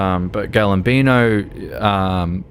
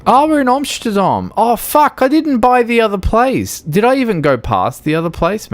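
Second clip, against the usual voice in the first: much higher, louder sudden alarm; startled; 13 semitones higher, 4 dB louder than usual. Real, not acted. Realizing in the game he's in Amsterdam, higher and startled. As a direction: catch the mistake mid-drive.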